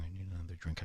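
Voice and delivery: very soft, muttered line; quiet. Retold or imitated. Man at a table, first take very soft. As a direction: say it low under your breath. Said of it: "I'm going".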